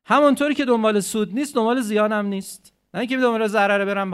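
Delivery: loud, emphatic sermon voice; preaching at full force. Acted. Preacher to congregation, loud and emphatic. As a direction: drive the point home to the congregation.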